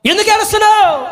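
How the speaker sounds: high, sustained ringing question; Jesus calling out. Acted. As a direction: intone the question high, acting the divine questioner. Acts Jesus asking why weeping. (ఎలుగెత్తి సాగదీసిన ప్రశ్న)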